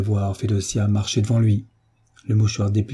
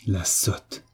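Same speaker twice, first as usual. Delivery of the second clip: muttered through teeth; 6 dB quieter than usual. A narrator's reading. Master grumbles about his wife, muttering through clenched teeth. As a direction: mutter it under your breath, teeth clenched. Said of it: "murmura le seigneur entre ses dents serrées".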